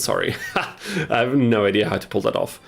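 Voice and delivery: ordinary delivery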